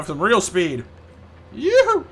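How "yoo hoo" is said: high, loud yoo-hoo; playful high-pitched holler; whooping call. Pretend. Calling out during play.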